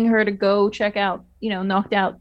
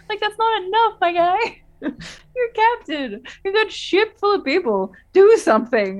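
laughing exasperation; 10 semitones higher than usual. Real, not acted.

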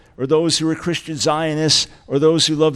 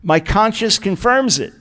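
higher, louder "My conscience confirms it"; emphatic; 4 semitones higher, 4 dB louder than usual. Shown as a demonstration. Pastor stressing Paul's fourth emphasis, higher and louder. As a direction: drive every word home. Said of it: "Emphasis number four".

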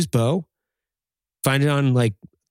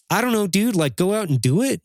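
high-pitched, acted-out exasperation; exasperated reply; 8 semitones higher than usual. Answering someone who asks how to find motivation, exasperated. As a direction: toss the obvious answer back, pitched up.

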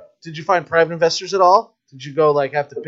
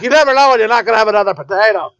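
fast, higher mock Irish uncle; 6 semitones higher, 5 dB louder, faster than usual. Uncle defending his pocket potato, mock Irish accent. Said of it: "He's like".

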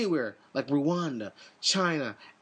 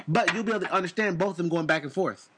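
ordinary talk, nothing marked

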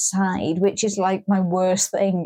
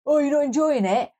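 mock obnoxious heckler, pitched higher; put-on higher voice, needling question; 5 semitones higher than usual. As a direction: imitate an obnoxious drunk at a gig. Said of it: "said something really obnoxious, like".

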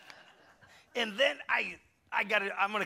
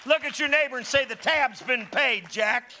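loud, higher call to the room; preaching call; 7 semitones higher, 7 dB louder than usual. Acted.

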